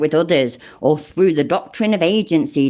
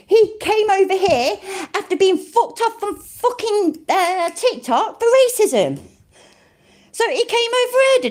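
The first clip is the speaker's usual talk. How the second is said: mocking; 12 semitones higher than usual. Real, not acted. Mocking the channel's claim.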